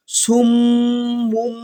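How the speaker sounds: সুমুম chanted very slowly, doubled mim hummed through the nose; ghunna on mim. Shown as a demonstration. Teacher showing the iqlab, slow nasal chant. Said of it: "এই জন্য বলতে হবে".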